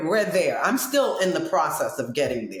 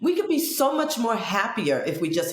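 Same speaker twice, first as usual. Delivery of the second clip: pitched up, fast lecturing; high preachy quote; 7 semitones higher than usual. Retold or imitated. Lecturing friend on the subway steps, high and fast. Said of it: "I said".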